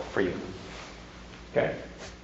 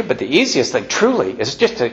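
usual speaking voice

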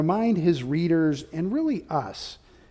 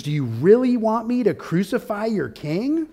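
higher, mocking rising question; 6 semitones higher than usual. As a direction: taunt them with the question.

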